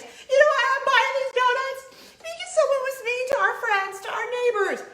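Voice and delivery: high, loud sung-out announcement; a pretend proclamation, half sung and high; sing-song proclamation. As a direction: belt it out like a proud herald. Imagined announcement in donut shop.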